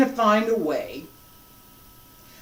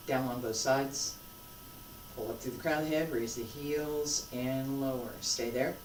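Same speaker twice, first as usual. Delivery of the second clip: guided meditation; 17 dB quieter than usual. Acted. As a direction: ease them into each breath, softly. Leads a guided breathing exercise.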